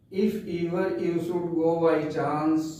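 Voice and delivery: very slow, measured poem reading; poem recitation. Shown as a demonstration. Teacher reciting the poem for class ten, slow recitation. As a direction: recite each phrase slowly with pauses.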